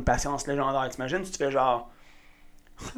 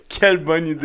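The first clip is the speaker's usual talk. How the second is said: laughing; 5 dB louder than usual. Real, not acted. Laughing reply.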